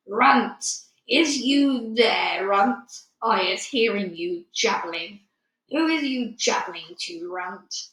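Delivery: slow, loud booming brute giant; thundering giant shout. Acted. The Bloodbottler calling for the BFG from outside, booming slow giant shout. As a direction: roar into the cave like thunder. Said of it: "a voice like thunder shouted".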